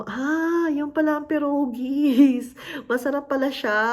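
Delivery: high, slow voice with a laugh; delighted discovery. Retold or imitated. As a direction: savor the surprise of a new tasty food. First taste of pierogies. (natuwang pagkatuklas, mataas at mabagal na may tawa)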